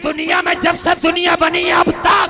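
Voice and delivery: high, thundering shout to the crowd; full-force preaching. Real, not acted. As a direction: proclaim it at the top of the voice. (गरजती हुई तक़रीर)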